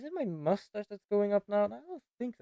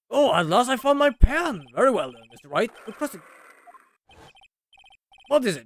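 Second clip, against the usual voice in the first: a lightly voiced judge, a bit higher; fussy judge; 5 semitones higher, 8 dB louder than usual. Judge asking the witness to stop shouting, prim.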